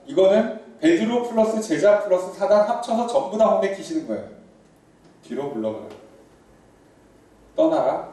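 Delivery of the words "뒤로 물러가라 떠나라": stern dismissal. Retold or imitated. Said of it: "꺼지란 뜻이죠".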